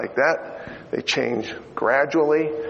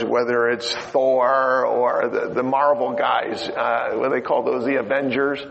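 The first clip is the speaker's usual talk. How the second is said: ordinary talk, nothing marked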